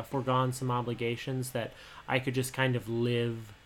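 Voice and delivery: usual speaking voice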